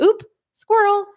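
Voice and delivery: high, loud yelp of distraction; a man's mind jumping to another girl, squealed high; sudden distraction. Retold or imitated. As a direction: blurt it like a dog spotting a squirrel. Mocking a distracted man.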